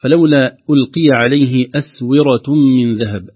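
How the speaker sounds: slow recited verse, the ra of أسورة heavy; slow recitation. Shown as a demonstration. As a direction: recite slowly to show the heavy ra. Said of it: "أسورة تفخيم الراء". (تلاوة بطيئة مع تفخيم الراء)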